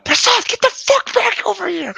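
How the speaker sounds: full-force high-pitched yell; shout down hallway. Retold or imitated. Student summoning his drunk friend down the dormitory hallway, shouting. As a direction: bellow it down a long corridor. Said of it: "And I shout down the hallway".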